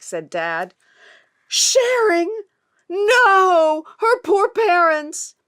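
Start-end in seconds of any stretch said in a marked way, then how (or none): 1.5-5.3 higher, louder shocked cry; shocked gasp. Acted. Mum reacting to gossip about Elsa, shocked high cry. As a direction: gasp in scandalised horror.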